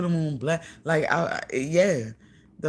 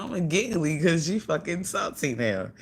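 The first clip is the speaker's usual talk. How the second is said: ordinary talk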